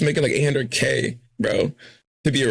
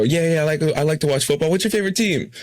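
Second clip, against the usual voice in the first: high imitating voice; 7 semitones higher than usual. Voicing the reporter's pitch.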